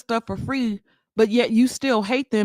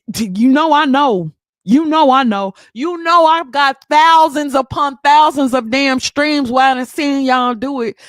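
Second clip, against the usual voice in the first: louder, pressing the point; loud insistence; 6 dB louder than usual. Real, not acted. Streamer to male viewers, loud and insistent. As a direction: lean in, insisting you know.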